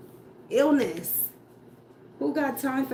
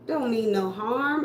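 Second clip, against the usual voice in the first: ordinary talk, nothing marked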